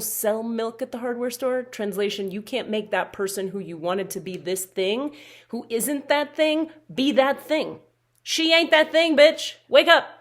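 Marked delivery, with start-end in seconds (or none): 5.5-10.1 loud, high, sassy callout; sassy shout. Acted. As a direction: yell it like tough love. Blunt tough-love punchline.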